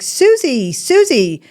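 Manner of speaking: loud, high call across the pond; shouting a name into the distance. Retold or imitated. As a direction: call the swan's name, frantic. Frantically calling missing swan.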